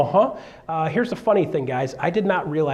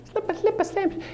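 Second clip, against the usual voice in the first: high, softer rap echo; 4 dB quieter than usual. Retold or imitated. Rapping the hook again for the students. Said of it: "I am a rapper".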